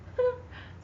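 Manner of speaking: high breathy giggle from the class; a quick unvoiced classroom snicker; class laughing. Students laughing at Grace wanting to be president, a short snicker. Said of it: "Several students in the class laughed".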